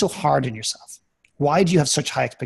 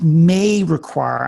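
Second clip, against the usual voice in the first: "may" stretched out and louder; stress on MAY; 3 semitones higher, 5 dB louder, much slower than usual. Real, not acted. Coach cautioning about a talk with parents, stressing "may". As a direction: lean slowly and hard on "may". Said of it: "I emphasize the word may".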